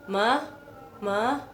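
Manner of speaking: "Ma" rising, as if calling mum; second tone. Shown as a demonstration. Learner imagined calling their mother, "Ma" rising like a question. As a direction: call "Ma" with an upward lift. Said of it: "misalkan kamu lagi manggil mama kamu".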